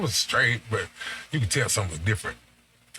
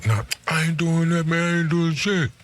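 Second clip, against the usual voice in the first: a lightly voiced guy brushing it off, pitched higher; mock denying man; 8 semitones higher than usual. A man denying to his girl, mock-defensive and higher. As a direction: play the guy insisting he did nothing.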